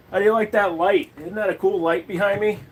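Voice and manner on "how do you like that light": usual speaking voice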